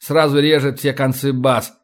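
ordinary delivery, nothing marked

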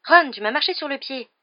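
loud, high-pitched complaint; indignant exclamation. A narrator's reading. Hermione scolding Ron for treading on her, exclaiming. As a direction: cry out in protest. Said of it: "s'exclama Hermione".

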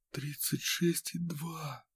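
slow, hushed awe; reverent whisper. A narrator's reading. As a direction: breathe it out in awe. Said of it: "почти благоговейным шёпотом".

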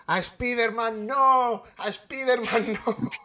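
high, drawn-out mock wail; imitated screaming child. Imitating a boy at his cinema during Spider-Man scene, mock screaming. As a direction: stretch it out like a child crying out in the cinema. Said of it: "un niño se puso a gritar".

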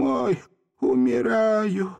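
high, drawn-out aged wail; rattling old man. A narrator's reading. Seryozha dying of old age, croaking. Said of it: "стариковским голосом".

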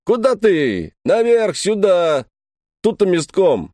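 shouting after him. A narrator's reading. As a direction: yell directions after him. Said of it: "кричал мне вдогонку".

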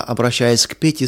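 ordinary delivery

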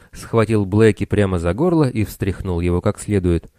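ordinary delivery, nothing marked

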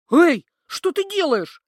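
loud startled yell; alarmed loud cry. A narrator's reading. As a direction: shout it up at her. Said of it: "завопил Хон".

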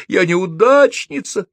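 high, stretched-out wail; screeching. A narrator's reading. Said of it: "верещала Софи". (протяжно верещать)

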